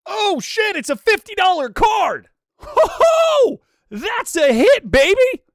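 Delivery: very high, loud celebratory yell; real excited shouting. Real, not acted. Streamer celebrating a fifty dollar card, shouting with excitement. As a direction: whoop at a jackpot pull.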